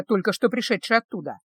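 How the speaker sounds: usual speaking voice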